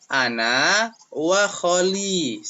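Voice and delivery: teacher repeats the answer for pupils, slow; slow model phrase. Shown as a demonstration.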